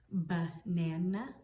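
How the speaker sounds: "banana" slowly in parts, rising at the end; sounded out. Shown as a demonstration. Teacher quizzing children on the I sound, slowly broken "banana". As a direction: ask "banana" as ba, nana with a questioning lift. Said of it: "Is there an I sound in".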